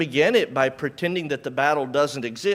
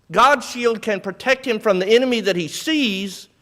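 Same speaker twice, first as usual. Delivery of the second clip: loud, driving sermon voice, louder throughout; full-force preaching; 7 semitones higher, 7 dB louder than usual. Acted. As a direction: preach it hard to the congregation.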